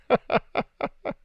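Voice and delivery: high, quick chuckle; breathy-voiceless giggle burst; laugh. Real, not acted. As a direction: laugh in a rapid, high run. Laughs after reading chat.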